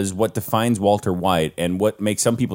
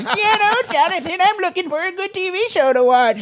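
very high-pitched put-on old lady voice; ninety-year-old grandmother. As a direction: squeak like a sweet elderly nana. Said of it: "my ninety-year-old grandmother goes".

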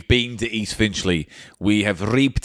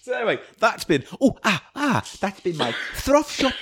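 shopkeeper voice; 10 semitones higher than usual. Acted. Shopkeeper sketch voice.

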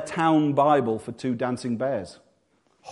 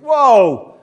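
loud, high, drawn-out whoa; overwhelmed cry; 8 semitones higher, 10 dB louder than usual. Acted. Reader swamped by temple details, overwhelmed cry. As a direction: cry halt at the flood of detail.

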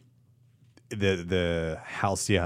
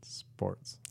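whispered sign-off; 13 dB quieter than usual. Acted. Show sign-off tagline.

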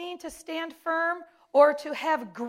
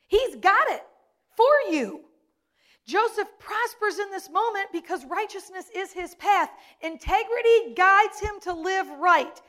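higher, louder preacher's build; preaching at full force; 6 semitones higher, 5 dB louder than usual. Acted. Preacher rousing the congregation about God leading them, full force. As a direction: lift the room, proclaim it.

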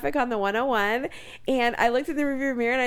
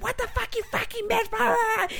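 high, mock furious yell; retold scream; 11 semitones higher than usual. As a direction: imitate a raging driver screaming again and again. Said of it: "screaming all this shit".

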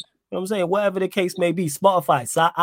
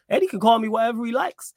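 raised voice; 8 semitones higher than usual. Real, not acted. To co-host about name calling, heated raised voice. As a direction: push the line up, getting worked up.

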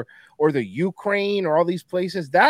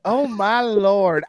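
slow Southern dandy, again drawn out; a second slow genteel Southern sigh; Southern dandy accent; 3 semitones higher, 4 dB louder, much slower than usual. Pretend. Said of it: "That's pretty good".

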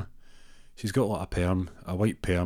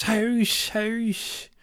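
put-on high old lady calling out, much higher; an old lady calls out her win across the hall; shouting house; 14 semitones higher than usual. Bingo winner's high call to the caller. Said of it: "one lady shouts".

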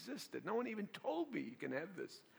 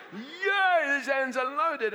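loud, high, jubilant cry; triumphant shout; 10 semitones higher, 12 dB louder, much slower than usual. Retold or imitated. Pastor recalling the pulpit after people fell, triumphant shout. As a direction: crow it out like a winner.

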